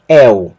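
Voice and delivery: "el" as éu, open e into u. Shown as a demonstration. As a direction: model "el" with a wide-open e. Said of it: "com o E aberto". ("el" como éu, com E aberto)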